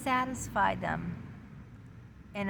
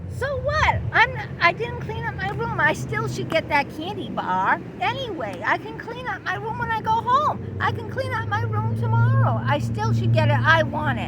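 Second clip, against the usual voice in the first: high, loud bratty protest; whiny ego; 10 semitones higher, 5 dB louder than usual. Acted. The ego arguing it still deserves the candy bar, high and loud. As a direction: argue your case like a spoiled kid.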